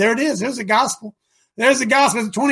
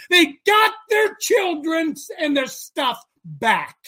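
slow, high shout of victory; triumphant; 6 semitones higher, slower than usual. Real, not acted. Preacher celebrating the spoils, slow and shouted. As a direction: drag it out in triumph.

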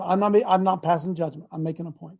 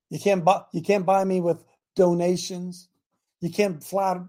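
ordinary talk

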